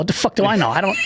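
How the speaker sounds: normal talking voice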